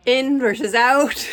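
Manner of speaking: real amused laugh through the words. Real, not acted. Laughing at cat going in and out.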